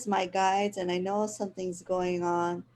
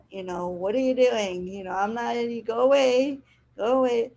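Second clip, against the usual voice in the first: louder mock shoo, go away; half-playful shoo, louder; shooing the cat; 3 semitones higher, 6 dB louder than usual. Sister shooing cat away, retold.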